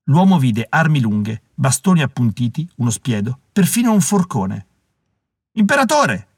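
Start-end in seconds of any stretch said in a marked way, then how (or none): 5.5-6.3 high-pitched call to the leader; acted outcry of a gang member; exclaiming savage. A narrator's reading. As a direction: hail your emperor, voice pitched up. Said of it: "esclamò uno dei selvaggi".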